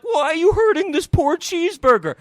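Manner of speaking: high, loud, wailing whine; mock sobbing plea for the burger; hammed-up high weepy voice; crying fat man. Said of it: "he's like".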